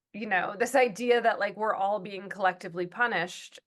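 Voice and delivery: ordinary talk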